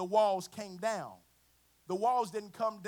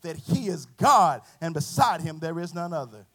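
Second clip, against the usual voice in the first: loud, building sermon climax; full-force preaching; 12 dB louder than usual. Acted. Preacher declaring victory to the church, full-force preaching. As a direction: proclaim it loudly, drawing out the big phrases.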